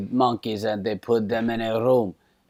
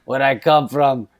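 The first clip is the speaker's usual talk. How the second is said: put-on louder, insistent Croatian cab driver accent; 5 semitones higher, 7 dB louder than usual. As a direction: protest in a raised Croatian accent. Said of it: "And he goes".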